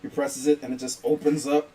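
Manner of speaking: normal talking voice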